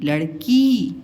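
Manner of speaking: लड़की with a long final ee; long ee ending. Shown as a demonstration. Teacher shows the long vowel in a word, held. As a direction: hold the ee at the end of लड़की. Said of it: "क में बड़ी की मात्रा की".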